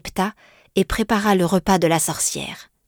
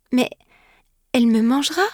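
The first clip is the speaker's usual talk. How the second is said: put-on higher, frightened girl's protest; lightly voiced young girl protesting; 6 semitones higher, faster than usual. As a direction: plead in worry, a touch scared. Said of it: "protesta la jeune fille".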